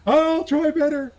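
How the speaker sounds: high, slow squeaky promise; tiny hammed-up kid voice; mock scared child. Eight-year-old Sally answering the priest, high and slow. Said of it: "You disappointed the family".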